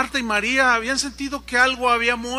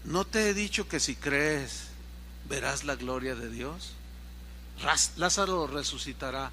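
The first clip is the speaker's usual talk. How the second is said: calma serena; 5 semitones lower, 5 dB quieter than usual. Acted. Jesús responde con calma.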